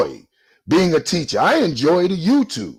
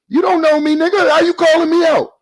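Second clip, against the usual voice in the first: higher, louder, indignant shout; angry outburst; 10 semitones higher, 5 dB louder than usual. Real, not acted. Calling out a rival who insulted him, high angry shout. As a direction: blast back at the insult.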